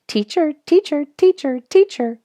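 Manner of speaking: high, loud sung-out bird call; shrill bird call. A narrator's reading. Narrator imitating the oven bird, high and loud. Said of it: "with a shrill".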